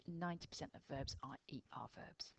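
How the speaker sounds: ordinary talk, nothing marked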